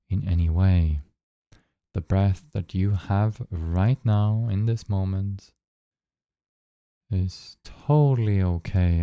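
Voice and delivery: calm, even guiding voice with long pauses; unhurried phrases, spaced out with long silences; guided meditation. Acted. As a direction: lead listeners gently into noticing their breath.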